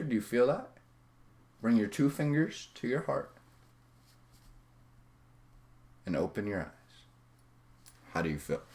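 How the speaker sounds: soft, hushed guidance; guided exercise. Real, not acted. Coach guiding eyes-closed gratitude to the heart, soft and quiet. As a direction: lead them gently, voice dropped.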